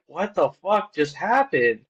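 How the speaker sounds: astonished, slow and high, drawn out; stunned disbelief. Retold or imitated. Producer stunned after hearing the long scream, astonished. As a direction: let your jaw drop at what you just heard.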